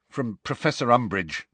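ordinary delivery

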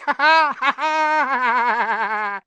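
high, loud, drawn-out mad laugh; long gloating cackle. Acted. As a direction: cackle on and on as you leave. Peeves gloats over tricked caretaker.

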